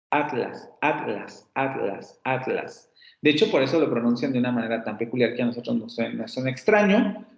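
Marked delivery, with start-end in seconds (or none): 0.1-2.9 "atlas" repeated as at-las, a small break after the t; peninsular at-las. Shown as a demonstration. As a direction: say each "atlas" with t closing the first syllable, softly falling. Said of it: "en español peninsular sería". ("atlas" repetido como at-las, con breve corte tras la t)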